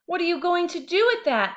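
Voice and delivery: high, loud amazed question; a little sister gaping at him; astonished little sister; wide-eyed sister. A narrator's reading. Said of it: "asked his wide-eyed sister".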